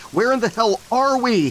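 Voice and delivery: high, loud frantic demand; demanding shout. Acted. Derek demanding answers from Josiah, high frantic shout. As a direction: yell it at him, desperate for answers. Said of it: "he demanded".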